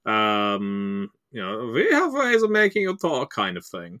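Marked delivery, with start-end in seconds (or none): 1.7-3.3 high, campy cartoon Nazi with German accent. Shown as a demonstration. Player demos his one German accent, campy cartoon villain. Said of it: "mine might be the cartoon Nazi".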